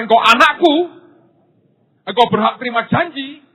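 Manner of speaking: loud and high, God's promise; preacher booms God's promise to the congregation, loud; booming proclamation. Acted. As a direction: proclaim it to the whole church.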